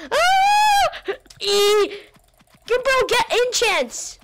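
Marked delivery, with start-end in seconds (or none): 0.1-1.1 startled scream. Real, not acted. Startled in the game.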